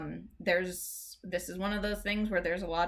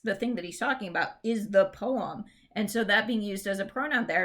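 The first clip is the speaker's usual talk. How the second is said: ordinary delivery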